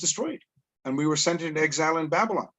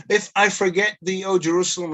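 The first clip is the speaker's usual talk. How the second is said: solemn recitation; 5 semitones higher than usual. Acted. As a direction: proclaim the verse like scripture from the pulpit. Reciting Psalm verse solemnly.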